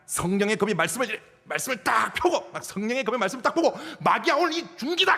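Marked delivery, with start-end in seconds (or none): 4.0-5.2 defiant challenge. Retold or imitated. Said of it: "하면서".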